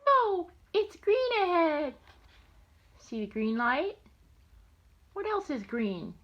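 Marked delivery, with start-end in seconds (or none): 0.1-1.9 very high, loud bird cry; little bird voice. Acted. Grandma voicing the little green bird, high and loud. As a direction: squawk it like a tiny bird.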